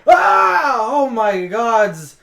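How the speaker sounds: high, loud yelp of alarm; shriek, then draw out the words in shock; startled fright. Acted. Game master plays a dwarf surprised in a broom closet, startled fright.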